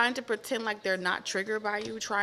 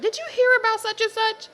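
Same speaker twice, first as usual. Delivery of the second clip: squeaky excited put-on voice, much higher; high loud gossip voice; 8 dB louder, faster than usual. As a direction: spread the news in a high shrill voice. Acted gossiping person.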